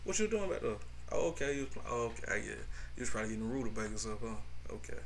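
soft, low, meek mumbling; mock clueless husband. Husband meekly accepting his wife's excuses, soft and low. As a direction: play the pushover husband agreeing quietly. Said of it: "He ain't even toy".

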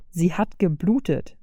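plain reading voice

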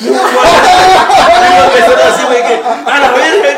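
high, loud laughter through the words; can barely talk for laughing; laughing loudly. Real, not acted. Bar catches fire, laughing loudly.

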